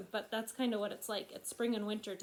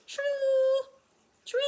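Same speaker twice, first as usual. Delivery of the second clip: drawn-out trilling frog cry; gray tree frog call; 9 dB louder than usual. Shown as a demonstration. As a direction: imitate a tree frog, stretching the vowel. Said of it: "The gray tree frog says".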